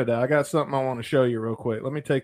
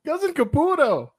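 put-on Canadian accent; 14 semitones higher than usual. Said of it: "talking like this".

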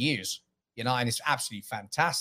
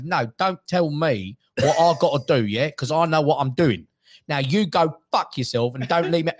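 forceful, drawn-out London tough-guy voice; Tom Hardy; 4 dB louder than usual. Acted. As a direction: play a defiant London hard man. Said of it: "Tom Hardy kinda talks like".

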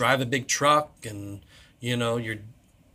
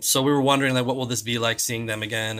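normal talking voice

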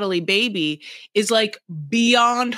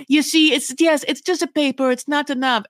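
mimicking Natalie; 6 semitones higher than usual. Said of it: "She's like".

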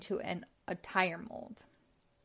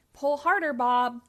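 loud, higher call to Bob; yell; 4 semitones higher, 6 dB louder than usual. A narrator's reading. Spud yelling at Bob as he pulls the plank. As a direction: shout encouragement at Bob. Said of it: "yelled Spud".

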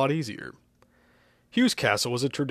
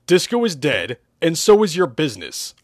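loud, pitched-up cool one-liner; action-hero cool, louder; 5 semitones higher, 8 dB louder than usual. Acted. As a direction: deliver the verdict confidently. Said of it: "I replied coolly".

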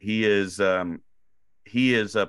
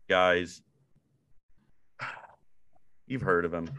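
ordinary delivery

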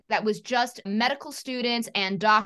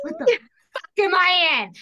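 high and loud, stunned; shocked alarm; 8 semitones higher, 6 dB louder, slower than usual. Retold or imitated. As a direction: blurt it out in alarm. Sudden alarm at the call.

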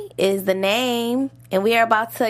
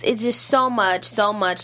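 normal talking voice

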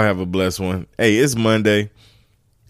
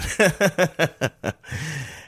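amused run of chuckles; laugh; 9 semitones higher than usual. Real, not acted. Laughing at himself doing it as a grown man, amused laugh. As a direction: burst into a long, high laugh. Laughing at his own remark.

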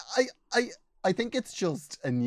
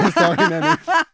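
laughing; 9 dB louder, much slower than usual. Real, not acted. Laughing apology after teasing.

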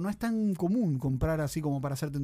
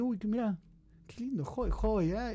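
mock delighted joya; 6 semitones higher, slower than usual. Shown as a demonstration. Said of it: "Joya es como decir".

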